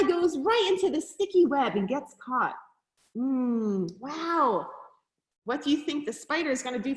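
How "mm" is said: ordinary delivery, nothing marked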